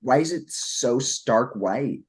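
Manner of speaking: ordinary delivery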